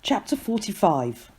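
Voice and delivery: plain reading voice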